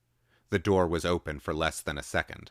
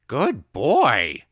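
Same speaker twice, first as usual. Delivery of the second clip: snorted praise; 8 semitones higher, 12 dB louder than usual. A narrator's reading. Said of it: "she snorted".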